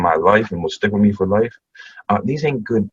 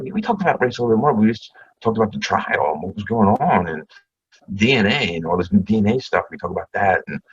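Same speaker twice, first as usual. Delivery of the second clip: ordinary talk, nothing marked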